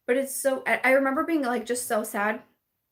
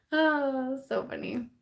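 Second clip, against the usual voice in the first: ordinary talk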